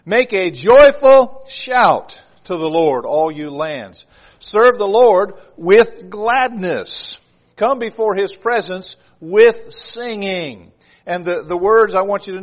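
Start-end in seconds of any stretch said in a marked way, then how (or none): 0.0-10.7 deliberate, loud verse with weight on "joyful shout", "with gladness", "with singing"; emphatic reading. Shown as a demonstration. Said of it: "with emphasis on certain words".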